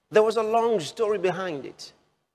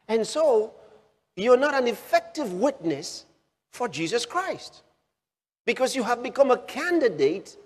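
raised, higher pitched preaching, pressing the point; preaching at full force; 5 semitones higher than usual. Real, not acted. Preacher warning his flock they need counsel, at full force. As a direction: drive it home to the congregation.

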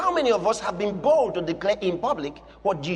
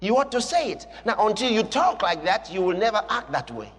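normal talking voice

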